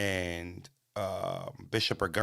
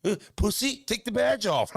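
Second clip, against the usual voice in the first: mock rowdy Canadian; 12 semitones higher than usual. Canadians cussing out the cops, mock rowdy high jeer. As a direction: play a drunk local mouthing off to a cop.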